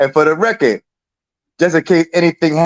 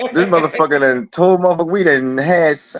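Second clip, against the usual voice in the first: normal talking voice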